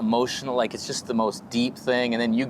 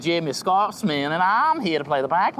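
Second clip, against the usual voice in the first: hammed-up mock Scottish introduction; a muddled, lilting Scottish accent; terrible Scottish accent; 3 semitones higher than usual. Comic introducing himself as a bagpiper, badly faked Scottish accent.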